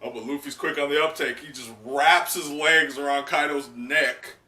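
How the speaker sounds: ordinary talk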